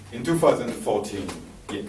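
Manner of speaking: ordinary delivery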